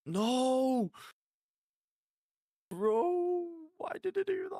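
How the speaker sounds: very high, strained "no, bro"; pleading at the screen in dismay; real shocked wail; anguished disbelief. Real, not acted. Despair at a player's decision.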